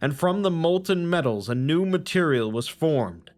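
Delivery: measured, solemn storytelling read; story narration. A narrator's reading. Narrator reading scripture to the audience, solemn storytelling. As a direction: tell it like old scripture to listeners.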